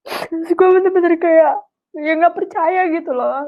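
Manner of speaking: real tearful voice, pitched high; sobbing between phrases, struggling to go on; crying. Real, not acted. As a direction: speak through tears of overwhelmed gratitude. Overcome recalling the gift, crying.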